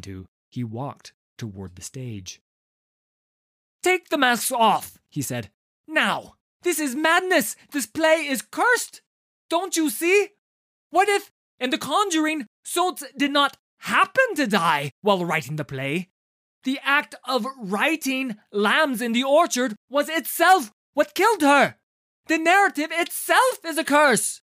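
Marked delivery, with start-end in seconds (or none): 3.8-5.0 loud, high demand; urgent command. A narrator's reading. Tarnold demands. 5.9-24.4 loud, high panicked rant; frantic shouting. A narrator's reading. Tarnold's frantic outburst.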